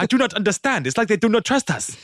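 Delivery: put-on high foreign-accented bewildered protest; Robert Azizu. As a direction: plead to the boss in a foreign accent. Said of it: "Robert Azizu going to his boss".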